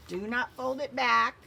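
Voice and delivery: "not" punched hard and loud, slowed down; loud slow warning with NOT hit hard; stress on NOT. Real, not acted. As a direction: warn them, leaning on "not" in "Do not fold this back". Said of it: "I'm just doing that so you can see".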